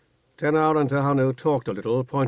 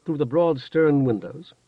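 ordinary delivery, nothing marked

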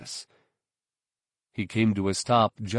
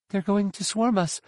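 high, alarmed warning; rattled; 10 semitones higher than usual. A narrator's reading. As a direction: blurt it out shaken. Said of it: "She sounded rattled".

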